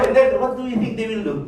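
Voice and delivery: usual speaking voice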